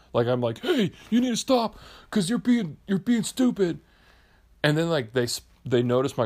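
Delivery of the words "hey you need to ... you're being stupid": high, strained mock telling-off; pretend scolding. Man imagines confronting middle school boys, high mock scolding. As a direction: act out a feeble confrontation with kids.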